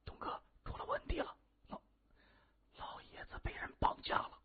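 urgent hushed whisper; whispering in the ear. A narrator's reading. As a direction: whisper bad news for one listener only. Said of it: "在谢文东耳边低声细语". (贴耳低声细语)

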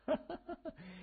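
high-pitched mad cackle; mad laugh. A narrator's reading. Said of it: "青年狂笑".

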